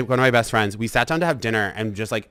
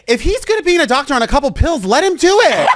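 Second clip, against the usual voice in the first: high, loud mock defense; retelling a heated couch argument; defiant outburst; 13 semitones higher, 8 dB louder than usual. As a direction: shout it up high in protest. Defending doctor to friends.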